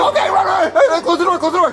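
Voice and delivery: high-pitched panicked orders; frantic urging. Acted. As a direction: shout it as you flee. Fleeing into pantry from zombies.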